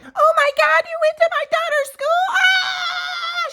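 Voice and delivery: very high mocking falsetto squeal; mocking his ex. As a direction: mimic her panicking in a squeaky falsetto, ending in a squeal. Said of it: "she's like".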